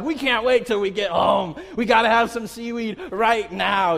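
loud, excited mock child; impatient kids. Kids in the car demanding seaweed, excited. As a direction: blurt it like kids who can't wait.

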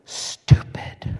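hushed, voiceless whisper. Retold or imitated. Small daughter confiding the bad word in mother's ear, whispered. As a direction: whisper it like a secret. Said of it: "she whispers in".

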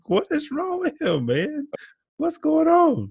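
high, stunned disbelief; baffled. Retold or imitated. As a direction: act floored by the weird laugh. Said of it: "I was like".

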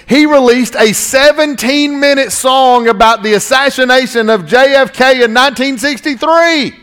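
high-pitched emphatic pulpit delivery; incredulous preaching. Real, not acted. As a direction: punch out each phrase in disbelief.

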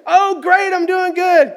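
high-pitched, upbeat small talk; cheerful greeting. Retold or imitated. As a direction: answer the phone brightly, high and chipper. Acting out a cheerful phone greeting.

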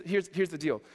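normal talking voice

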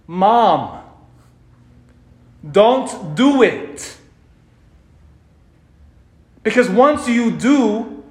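high, loud desperate plea, slowed; begging Mom not to do it, crying out high; pleading scream. Pretend. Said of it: "Imagine all of us there screaming".

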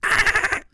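high squeaky dolphin chirp; raspy dolphin squeal imitation; chirpy clicking dolphin. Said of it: "was a little raspy this morning".

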